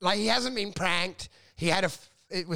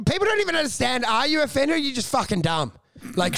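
ordinary talk

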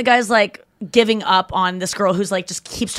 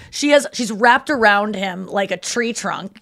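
usual speaking voice

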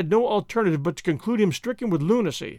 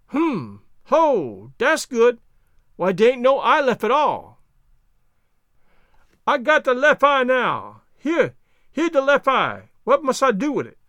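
higher, louder dialect voice, slowing on the discovery; Jupiter, puzzling it out; 5 semitones higher, 4 dB louder, slower than usual. Acted. Servant hunts skull's left eye, raised dialect voice. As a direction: work it out aloud as the servant.

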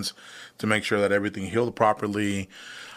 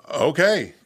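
a high, louder dazed okay; stunned okay; 7 semitones higher, 5 dB louder than usual. Retold or imitated. A dazed okay to his dad about forty surgeries. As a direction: swallow the news with a stunned okay.

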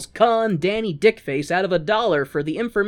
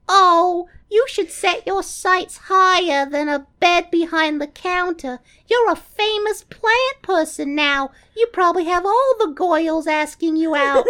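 high Audrey voice; 11 semitones higher than usual. Retold or imitated. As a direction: squeak it like sweet Audrey. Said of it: "Audrey Classic is like".

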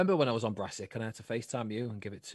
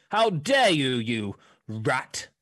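hammed-up stage indignation; thespian; 7 dB louder, much slower than usual. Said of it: "You say it like a thespian".

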